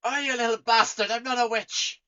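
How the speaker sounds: high, loud offended retort; indignant tavern keeper. Acted. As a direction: snap back, insulted.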